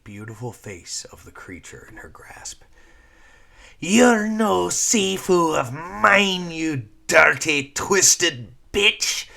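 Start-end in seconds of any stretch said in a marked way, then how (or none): 3.8-9.3 much louder, higher, slow Scottish snarl; Scottish sneer. Acted. Lyra spitting at her former master, Scottish sneer. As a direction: spit it out in a thick Scottish accent. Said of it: "sneered Lyra, her Scottish accent rearing up".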